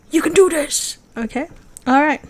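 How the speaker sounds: loud, high rallying cheer; cheering encouragement. Real, not acted. Vlogger urging viewers not to give up, cheering. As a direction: call it out like a pep talk.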